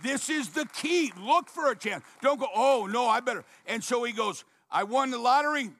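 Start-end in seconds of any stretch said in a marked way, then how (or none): 0.0-3.4 higher and louder, driving the point home; fervent sermon push; preaching emphasis. Real, not acted. Preacher exhorting congregation. 4.7-5.7 higher, louder and fast; excited retelling. Retold or imitated. Retold quote, repeated louder.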